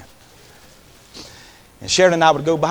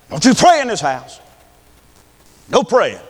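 loud, high retold bellow; mock furious order, raised voice; angry stepfather; 8 semitones higher, 10 dB louder than usual. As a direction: forbid it with an imitated shout. Stepfather interrupts grandmother's prayer, retold.

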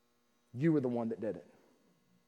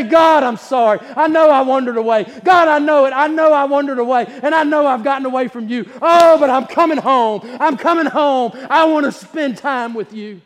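loud, high pleading prayer cry; fervent prayer; 8 semitones higher, 11 dB louder than usual. Acted. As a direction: pour it out to God, full preaching force. Prayer voiced at full force.

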